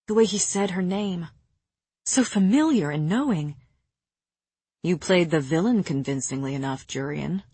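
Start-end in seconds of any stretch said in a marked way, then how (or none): 4.8-7.4 low, understated purr. A narrator's reading. Rhys needles Jurian about playing villain, purring. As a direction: drop the pitch and purr it. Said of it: "Rhys purred".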